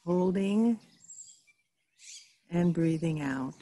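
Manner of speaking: guided meditation. Real, not acted. Reverend guiding listeners into stillness through breathing, calm guided meditation. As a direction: lead them gently through each breath, leaving space.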